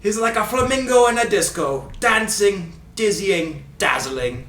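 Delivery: put-on loud Scottish commentator accent, starting high and stepping down; showy Scottish commentator impression; Ray Hudson accent. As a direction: relish each word in a put-on Scottish accent. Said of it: "do my best Ray Hudson accent".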